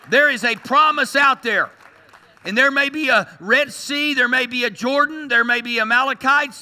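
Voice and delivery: loud, high, rousing preacher's cry; a pastor rallying the church to keep going; preaching at full force. Acted.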